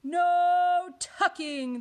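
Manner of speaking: very drawn-out high, loud cry; crew crying out. A narrator's reading. Crew backs up captain's refusal, crying out. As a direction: stretch it into a crew chorus. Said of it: "the crew cried".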